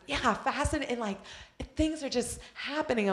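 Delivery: high, bright-eyed mimic of a churchgoer; hammed-up imitation of people gushing; mock excited churchgoer. Mocking people's fasting talk.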